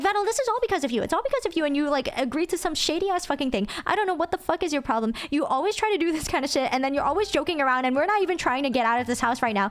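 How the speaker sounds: fast, high-pitched scolding with little added volume; mock yelling rant. Yelling at Vettel while stuck in a haunted house, mock angry. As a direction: tear into him in a rapid, hammed-up rant. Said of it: "would yell at Vettel this entire time".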